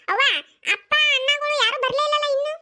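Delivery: put-on little daughter asks her mother, in a high child's voice. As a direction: ask it like a small girl waiting for her dad. Child asks after her father.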